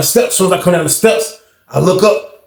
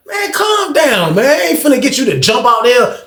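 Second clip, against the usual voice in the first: high shout; 7 semitones higher than usual. Retold or imitated. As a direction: yell it up high to calm him. Telling old head to calm down.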